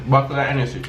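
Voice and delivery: "Buckle" for bottle, with a K. Shown as a demonstration. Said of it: "That we say".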